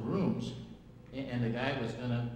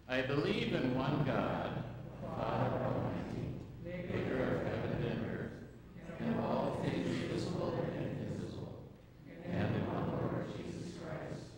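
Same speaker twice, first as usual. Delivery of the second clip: creed recitation; 3 dB quieter than usual. Acted. Congregation recites Nicene Creed.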